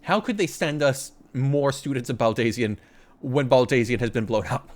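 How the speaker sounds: ordinary talk, nothing marked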